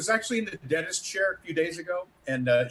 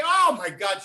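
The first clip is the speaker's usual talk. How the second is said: loud fan gasp of amazement; retold starstruck outburst; 3 semitones higher, 7 dB louder, slower than usual. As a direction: gush it, thrilled and loud. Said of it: "she's starstruck".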